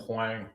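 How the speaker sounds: "wang", a joke way of saying wing; joke pronunciation. Shown as a demonstration. Streamer showing viewers the car's wing, joke pronunciation "wang". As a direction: say "wang" with an A vowel, as wing's pronunciation. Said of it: "which is pronounced wang".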